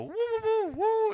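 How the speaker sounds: very high siren wail; police siren imitation. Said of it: "he just hears cops go".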